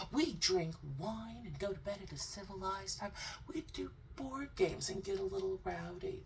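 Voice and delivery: hammed-up outraged innocent; mock indignant snob. Mocking the guest's indignant denial.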